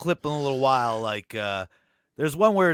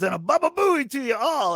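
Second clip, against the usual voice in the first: Fred impression shout; 10 semitones higher than usual. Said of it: "he goes".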